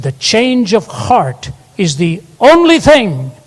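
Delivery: preaching emphasis. Acted. Preacher quoting writer.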